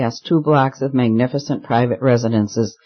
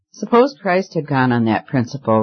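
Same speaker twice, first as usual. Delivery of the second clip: usual speaking voice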